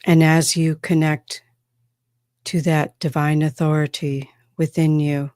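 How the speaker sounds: measured, solemn spokesperson from another world; channeled extraterrestrial. Acted. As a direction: speak as a trance channel, in deliberate phrases with long pauses. Speaks as a channeled extraterrestrial being.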